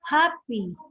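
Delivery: "happy" with HAP stressed; stress on first syllable. Shown as a demonstration. Teacher listing example adjectives, first-syllable stress. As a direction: hit HAP in "happy", then let it fall. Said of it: "stress here is found in the first".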